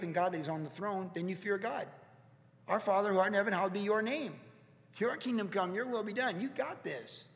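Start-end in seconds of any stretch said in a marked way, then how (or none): 2.7-6.4 prayer. Acted. Pastor reciting the Lord's Prayer.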